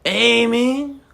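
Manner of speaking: high, slow hammed-up Spanish accent; a silly put-on Spanish accent. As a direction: greet him like a cartoon Spanish dude. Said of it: "Spanish accent".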